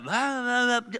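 mock baby-talk nonsense; toddler babble. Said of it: "They talk like this".

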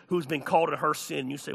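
ordinary talk

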